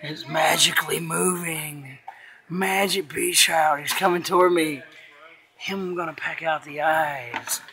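slow, hushed mock menace; playful spooky. Dad teasing child about the magic toy, playful spooky. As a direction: draw it out softly, play-spooky.

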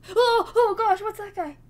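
high, loud yelp; startled. Real, not acted. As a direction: blurt it out in fright. Startled by a figure.

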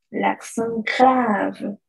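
l'accent grave drawn out slowly, nasal ent; French l'accent grave. Shown as a demonstration. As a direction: say the accent's name slowly. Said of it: "un acento grave".

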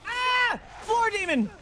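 very high shrieked cry; frightened scream. Real, not acted. Screams at demon on the floor.

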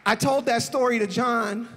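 usual speaking voice

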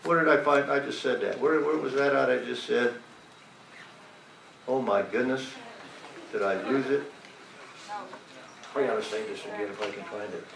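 low, very soft aside while searching; muttering to oneself. Real, not acted. Preacher hunting for a lost verse, muttering to oneself. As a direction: drop right down, talking to yourself. Lost his place, talks to himself.

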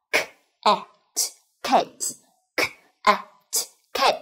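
k, a, t softly split, then cat blended together; native sounding out. Shown as a demonstration. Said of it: "cách đọc hoàn chỉnh ... của người bản ngữ".

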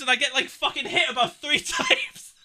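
high-pitched, laughing through the words. Real, not acted. Vlogger telling how he got hit, high and laughing. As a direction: break into laughter as you tell it. Laughing while retelling being shot.

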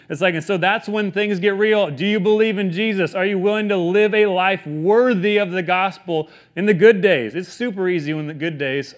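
ordinary delivery

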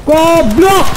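real rage-shout at a teammate; angry yell. Real, not acted. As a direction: blow up at the teammate. Yelling at teammate's mistake.

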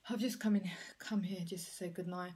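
hushed bedtime whisper. Real, not acted. Vlogger whispers goodnight to viewers at bedtime, whispering. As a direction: whisper so you don't wake anyone. Night, quietly checking in.

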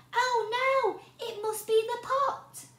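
high, loud dismay; alarmed Hannah. A narrator's reading. Hannah discovering the porridge flood, high dismay. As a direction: gasp it out in alarm.

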